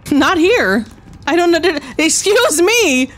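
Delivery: high-pitched, flustered objection; flustered protest. Real, not acted. Player getting choked out in the game, flustered. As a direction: protest in a rising squeal. Being attacked in the match.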